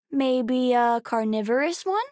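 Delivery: usual speaking voice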